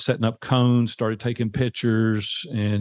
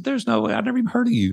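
incredulous dismissal; 12 semitones higher, much faster than usual. Retold or imitated. Turning down the radio ad offer.

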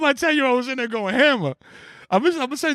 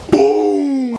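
loud boom sound; 5 semitones higher, 6 dB louder than usual. Retold or imitated. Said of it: "You're gonna be like".

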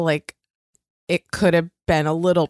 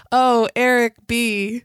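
slow, higher hammed-up sex moan; mock lover's cry; 5 semitones higher, slower than usual. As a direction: draw out the name as if in bed. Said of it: "And she goes".

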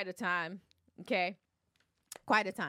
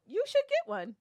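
high-pitched indignant comeback; indignant retort; 14 semitones higher, 4 dB louder than usual. Retold or imitated. Diner tells waiter to get a manager, indignant. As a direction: fire it back much higher.

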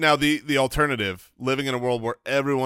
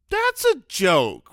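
put-on child's dawning realization; 9 semitones higher, 3 dB louder, slower than usual. Imitates child grasping a joke.

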